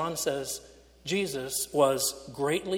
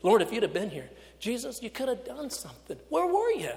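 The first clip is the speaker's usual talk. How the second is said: rising higher to a cry of where were you; pleading faith; 6 semitones higher than usual. Acted. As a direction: ask it as a plea, not blame. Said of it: "Today maybe it's a profession of faith".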